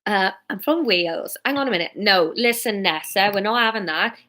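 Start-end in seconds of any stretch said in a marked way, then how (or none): 0.5-1.3 practice phrase in a Welsh accent. Shown as a demonstration. Narrator warms up for Fiona's voice, Welsh accent. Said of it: "Welsh accent". 2.0-4.2 practising a Welsh character voice; lilting Welsh accent warm-up. Shown as a demonstration. Narrator rehearses before Fiona's line, Welsh accent. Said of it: "Welsh accent".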